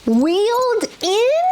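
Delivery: high, slow disbelieving echo; incredulous. Real, not acted. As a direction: drag it out in disbelief. Reacting to the book blurb.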